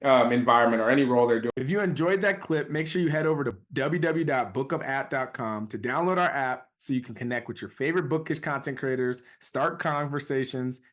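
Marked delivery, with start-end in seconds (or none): 1.6-10.8 softer, even outro ad read for the app; promo read. Acted. Host to listeners, plugging the Bookup app: soft outro read. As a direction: wrap up the episode with a calm sponsor plug.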